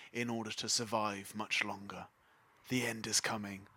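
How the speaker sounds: low, hushed doom-laden priest sinking to a whisper; ominous priest prophecy. Acted. Priest warning the party to convert, growing hushed and ominous. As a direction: foretell the end like a zealot.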